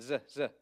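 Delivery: "Z, Z" as a buzzing z sound. Shown as a demonstration. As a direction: buzz the "Z" twice. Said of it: "it's our Z sound".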